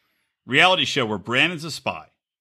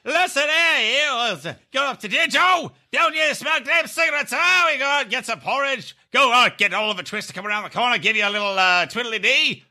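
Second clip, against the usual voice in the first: Cockney psychic; 8 semitones higher than usual. Retold or imitated. Mocking the Cockney psychic for friends in a hammed-up Cockney accent. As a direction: ham up a Cockney fortune teller. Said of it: "her Cockney psychic".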